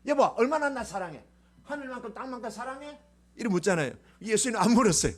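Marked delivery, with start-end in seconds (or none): 0.1-3.0 wife's cute question. Retold or imitated. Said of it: "보통 여자들은 뭐예요?".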